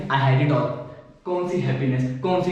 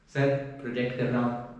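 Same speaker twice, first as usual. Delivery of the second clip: soft meek "sir, project कर रहा हूँ"; timid reply; 5 semitones lower, 7 dB quieter than usual. Retold or imitated. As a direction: answer the boss meekly, very softly. Employee timid answer. (डरा हुआ धीमा जवाब)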